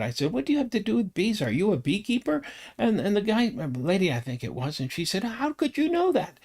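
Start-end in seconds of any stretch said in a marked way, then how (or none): 0.3-2.4 eager quick question. Retold or imitated. 5.2-6.4 amazed lady. Retold or imitated. Retold lady's amazement.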